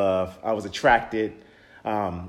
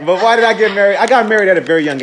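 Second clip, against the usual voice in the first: laughing, higher and louder; 9 semitones higher, 7 dB louder than usual. Real, not acted. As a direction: laugh through the question. Laughs after spitting out gum.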